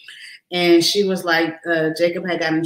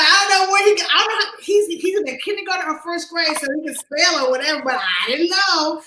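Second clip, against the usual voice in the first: embarrassed defence; 9 semitones higher than usual. Retold or imitated. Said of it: "that was a little kinda embarrassing".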